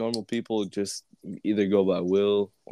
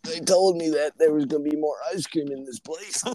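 a bit higher, voiced old man; mock Biden voice; 6 semitones higher than usual. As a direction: play along as a naive old politician. Joining the Biden voice.

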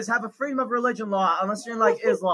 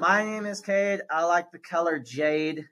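slow, lower sing-song rhyme; rhyming kid intro; 5 semitones lower, 8 dB quieter, slower than usual. Shown as a demonstration. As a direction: recite the rhyme like a grade-schooler. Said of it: "It's gotta rhyme".

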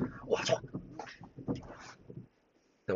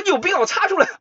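annoyed retort; 4 dB louder, faster than usual. Retold or imitated. Tester snapping back.

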